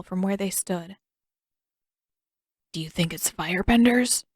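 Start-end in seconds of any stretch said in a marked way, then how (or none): none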